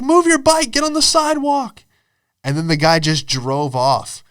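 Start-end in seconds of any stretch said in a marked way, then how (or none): 0.0-1.8 yelling cab driver. Retold or imitated. Cab driver blaming the cyclist he hit, high mock yell. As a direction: imitate an irate driver, pitched way up. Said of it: "starts yelling at the guy on the bike".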